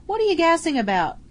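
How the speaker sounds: high, loud scornful shout; irritated bellow. Acted. Soldier bellowing at his mate while aiming, irritated. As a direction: shout him down while taking aim. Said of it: "the other soldier bellowed".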